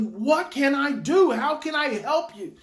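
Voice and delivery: demonstrating an urgent, emphatic question. Shown as a demonstration. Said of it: "just ask him emphatically".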